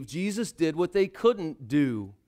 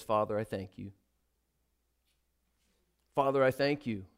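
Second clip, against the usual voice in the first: low, soft thanks to the Father; quiet, reverent thank you; hushed prayer; 7 semitones lower, 7 dB quieter, faster than usual. Acted. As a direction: breathe it out like a whispered prayer. Jesus praying quietly.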